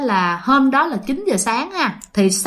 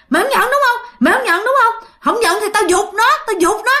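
very high-pitched, menacing ultimatum; fierce threat; 10 semitones higher, faster than usual. Acted. Strange woman threatening to drop the baby, fierce. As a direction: threaten in a sharply raised, angry voice. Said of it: "cái mặt bà trở nên hung dữ lạ kỳ".

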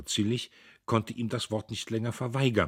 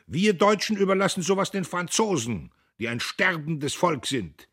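higher, louder patriotic oration; rousing speech; 9 semitones higher, 6 dB louder than usual. A narrator's reading. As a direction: thunder it to the hall. Diederich's speech to the crowd.

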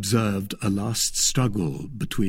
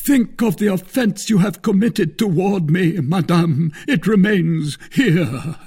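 high, loud theatrical reproach; Porthos, wounded suitor; 9 semitones higher, 6 dB louder than usual. Acted. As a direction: play a grandly injured lover.

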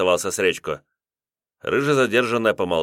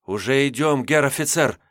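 babbling; 6 semitones higher than usual. A narrator's reading. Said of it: "лепетал Тимофей".

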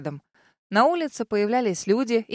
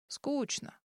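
bored; 4 semitones higher, 13 dB quieter than usual. Acted. Dragon bored.

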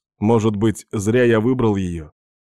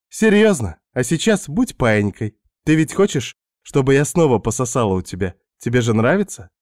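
Svetka's voice; 3 semitones higher than usual. Acted. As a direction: play the pushy houseguest luring him in. Narrator voices seductive Svetka.

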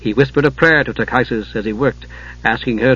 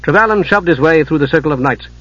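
ordinary delivery

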